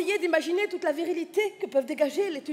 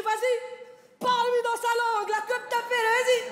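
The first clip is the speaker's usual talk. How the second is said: higher, louder eager urging; boss egging on; 7 semitones higher, 3 dB louder than usual. Retold or imitated. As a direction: push her on like an excited boss. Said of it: "il me dit". (patron excité qui pousse à parler)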